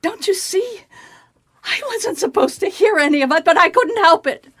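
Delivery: a frightened secretary breaks down; distressed outburst. Acted. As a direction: near tears, begging to be understood. Maid pleads, refusing to tell.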